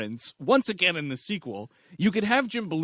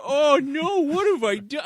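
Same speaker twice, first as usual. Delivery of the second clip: higher, hammed-up mock panic; mock dismay; 9 semitones higher, 4 dB louder than usual. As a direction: overplay the regret. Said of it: "he's like".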